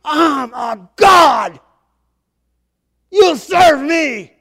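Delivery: slow, high, booming villain shout; drawn-out, full-volume tyrant yell; Loki yelling. Acted. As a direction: bellow commands like an imperious god. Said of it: "he yells at him".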